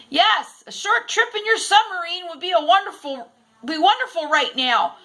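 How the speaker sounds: high, loud shout over wind; shouted back. A narrator's reading. Teacher shouting to Captain Nemo above the wind. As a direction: shout over the roaring storm. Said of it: "Miss Smith shouted back".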